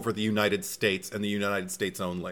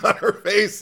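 usual speaking voice

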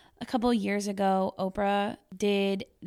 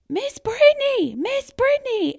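much higher, louder call of a name across a field; 9 dB louder than usual. Retold or imitated. Boys running down the sideline to their teacher, calling loudly. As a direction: shout her name as you run back.

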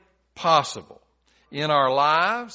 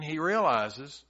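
usual speaking voice

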